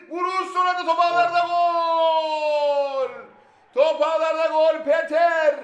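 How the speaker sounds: drawn-out goal scream; screaming football commentator. Real, not acted. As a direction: roar the goal call. Goal call.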